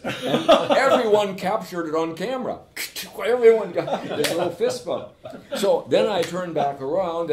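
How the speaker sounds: high, loud laughing delight; laughing while telling. Real, not acted. Telling the friend about the fist bump, laughing. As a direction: bubble over with laughter at the memory.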